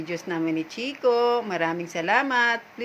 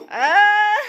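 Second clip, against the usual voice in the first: high squealing laugh; 11 semitones higher, 6 dB louder than usual. Real, not acted. Playing fetch with her dog.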